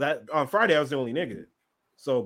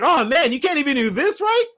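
mocking taunt; 9 semitones higher, 6 dB louder than usual. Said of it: "He's like".

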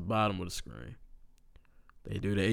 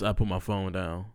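ordinary talk, nothing marked

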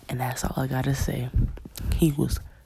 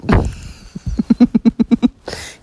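loud sustained laughter; 8 semitones higher, 8 dB louder than usual. Real, not acted. Mocking the date's shock, long loud laugh. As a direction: laugh hard at him.

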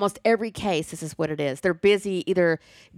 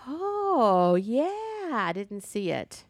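mild surprise; 5 semitones higher, much slower than usual. Retold or imitated. Co-host recalling a makeover reveal, mild surprise. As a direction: pleasantly caught off guard, not shocked. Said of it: "Not shocked, but just like".

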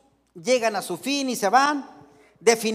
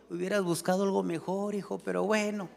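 lowered, softer aside; mock confiding in ear; 3 semitones lower, 6 dB quieter than usual. Parent privately advising son at wedding hug, mock confiding. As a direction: murmur it privately, as during a hug. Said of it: "te dicen al oído".

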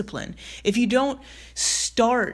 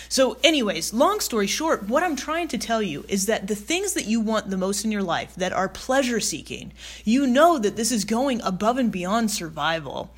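ordinary talk, nothing marked

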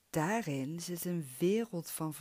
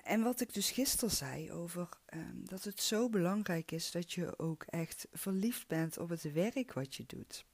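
usual speaking voice

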